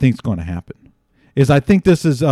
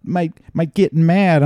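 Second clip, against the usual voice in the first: high-pitched, hedging mock voice; imitated worried sources; 7 semitones higher than usual. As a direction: fret in a raised, put-on voice. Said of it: "But they're like".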